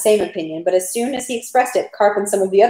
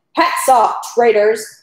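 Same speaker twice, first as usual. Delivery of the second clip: full-blooded cry; 3 semitones higher, 4 dB louder, much slower than usual. A narrator's reading. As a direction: narrate a moderately forceful order to a crowd. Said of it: "cried the full-blooded voice of Rostov".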